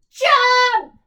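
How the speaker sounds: huge high shout; yell. Acted. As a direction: roar it at the troll. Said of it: "yelled the pirates".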